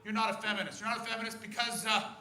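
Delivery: put-on indignant old woman. Angry woman explaining to the comic, a mild mock shrill lecture. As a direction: lay down the law to him. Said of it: "she composed herself".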